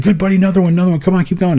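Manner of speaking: high-pitched coaching, imitating a doctor; urgent encouragement. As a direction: urge a patient on, breath by breath. Doctor coaching him to breathe.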